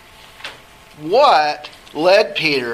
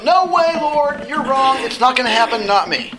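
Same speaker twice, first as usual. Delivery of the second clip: indignant protest; 9 semitones higher, 3 dB louder than usual. Retold or imitated. Teacher voices Peter protesting Jesus.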